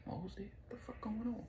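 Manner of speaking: breathless phone whisper; hushed whisper. Retold or imitated. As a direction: whisper in alarmed disbelief.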